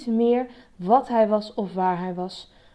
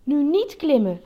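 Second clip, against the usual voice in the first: firm note to self, higher; self-admonishing; 6 semitones higher, 4 dB louder than usual. A narrator's reading. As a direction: tell yourself off before temptation. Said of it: "zei de olifant tegen zichzelf".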